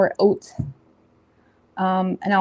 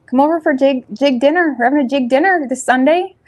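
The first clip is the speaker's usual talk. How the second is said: higher, louder Newfoundland invite; Newfoundland accent; 5 semitones higher, 5 dB louder than usual. Retold or imitated. Inviting viewers to jig dinner in a Newfoundland accent. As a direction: invite them over like a Newfoundlander. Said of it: "In Newfoundland".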